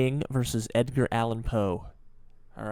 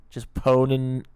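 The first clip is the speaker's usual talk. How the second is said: slow, louder gamer taunt on poning noobs; mock gamer trash talk; 7 dB louder, slower than usual. Reactor mimicking a 2008 gamer, mock loud taunt. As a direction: ham up an old Call of Duty kid. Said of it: "two thousand eight YouTuber Call of Duty term".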